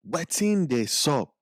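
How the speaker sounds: slow, careful Pidgin English phrase; Pidgin demo. Shown as a demonstration. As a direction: say it slowly so they can repeat. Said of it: "say it back with me".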